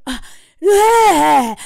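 loud mock exasperated groan; imitated husband's wordless outburst; on-edge groan. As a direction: groan loudly in mock agitation. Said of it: "He's clearly so on edge".

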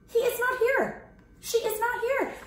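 high little voice, searching; puzzled Piggie. Acted. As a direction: look around, puzzled.

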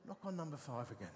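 very soft, low inner prompting; little voice. Retold or imitated. Inner voice prompts vicar on the street, little soft voice. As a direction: drop right down for a quiet imagined nudge. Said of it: "Little voice says".

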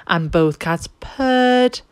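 ordinary delivery, nothing marked

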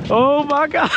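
high, loud amazed cry; excited. Real, not acted. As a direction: gasp it out at the jump. Tarpon jumping.

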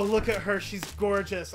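ordinary delivery

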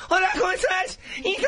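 very high, squealing mock Tijuana voice; Tijuana accent. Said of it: "the ones from Tijuana".

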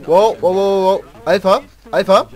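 loud, high calling out; loud gamer yelling; excited shout. Real, not acted. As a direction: shout his name across the map. Shouting at teammate in game.

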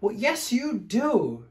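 loud, high insistence; storybook shout. A narrator's reading. Fluffy bear winning the bath argument, high loud shout. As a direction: shout it back emphatically. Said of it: "shouted Big Fluffy Bear".

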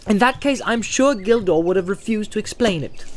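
sharp, impatient retort. A narrator's reading. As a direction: snap back sharply. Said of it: "said Frodo sharply".

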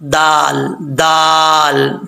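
दाल with long held aa; tongue tip at upper tooth roots, stretch दाल; articulation demo. Shown as a demonstration. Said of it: "तो दाल पढ़ा जाता है".

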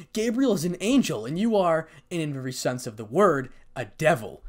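ordinary delivery, nothing marked

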